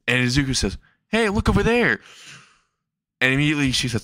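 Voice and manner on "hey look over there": high, put-on distraction; high character voice. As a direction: squeak it out as a trick. Izuku trying a distraction.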